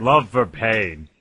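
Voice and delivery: slow, drawn-out title read. Acted. Announcing the fanfic title slowly to viewers. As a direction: stretch each word out slowly.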